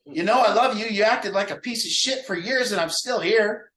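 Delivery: high-pitched exasperated insistence; mildly hammed-up scolding, pushed up in pitch; mock yelling. Relative scolding a loved one after years, mock exasperation. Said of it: "after you get done yelling at somebody".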